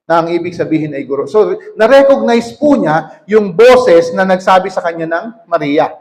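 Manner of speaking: normal talking voice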